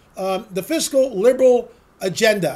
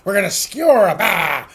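pirate voice; 4 dB louder than usual. Pretend. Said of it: "I'm channeling my inner pirate".

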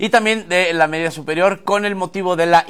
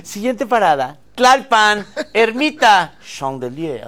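mock metro announcer; 3 semitones higher, slower than usual. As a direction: announce stops, finish in suave French. Mock metro stops, French last name.

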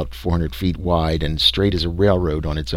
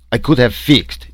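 put-on higher-pitched German-accented protest; exclaiming German engineer; 5 semitones higher, 4 dB louder than usual. A narrator's reading. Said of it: "ejaculated the engineer".

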